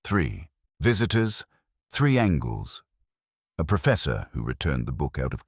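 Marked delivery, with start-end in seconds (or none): none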